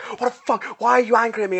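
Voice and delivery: retold indignant challenge; having a go. Retelling a friend having a go over a text, mock indignation. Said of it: "they had a go at me".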